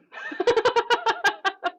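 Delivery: high, rapid string of short pulses; laugh-like pulsing warm-up; staccato breath exercise. Shown as a demonstration. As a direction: bounce quick high bursts on one pitch. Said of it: "Try that one".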